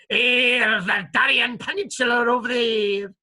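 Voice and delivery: loud, lightly put-on pirate voice; pirates going. Pirate answering Patterson about Darien, loud mock pirate voice. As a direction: point it out like a sly pirate. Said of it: "And the pirates going".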